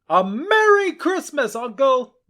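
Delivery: high, bright, ringing call; cheery greeting. Acted. Nephew wishing his uncle a merry Christmas, ringing. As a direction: call it out joyfully.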